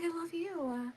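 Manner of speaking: very soft, high little voice; tiny gushing squeal. Retold or imitated. Mother re-enacting her love for her son, in a tiny high squeal. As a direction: squeak it out tenderly, barely audible. Replays the gushing reply, now small.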